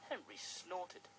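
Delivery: plain reading voice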